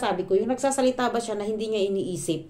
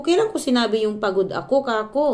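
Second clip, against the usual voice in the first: loud, higher, challenging question; indignant retort; 4 semitones higher, 6 dB louder than usual. Retold or imitated. Vlogger challenging her mother-in-law, indignant and loud. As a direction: demand when she ever said it. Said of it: "Sabi ko".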